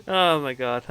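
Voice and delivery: winded from laughing, giggles breaking through; out of breath. Real, not acted. Player excusing themselves to viewers, breathless from laughter. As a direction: catch your breath after a laughing fit. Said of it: "I'm a little out of breath from laughing so hard".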